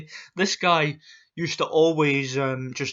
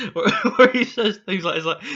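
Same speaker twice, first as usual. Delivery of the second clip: ordinary talk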